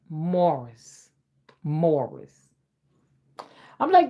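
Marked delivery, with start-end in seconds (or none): 0.1-2.5 MORRIS drawn out with an "or" vowel; own pronunciation. Shown as a demonstration. Mom settling on her way, Morris as "MORE-iss". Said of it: "I say Morris".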